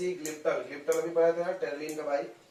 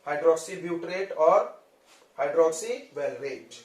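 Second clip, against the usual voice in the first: hydroxy butyrate and hydroxy valerate drawn out slowly; slow, careful naming of both parts; slow deliberate naming; 3 dB louder, much slower than usual. Shown as a demonstration. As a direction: spell the two names out for the class. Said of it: "ये दो लोग हैं वे".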